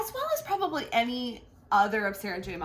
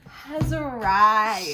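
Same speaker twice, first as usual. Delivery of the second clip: grand announcement; 8 dB louder, much slower than usual. Acted.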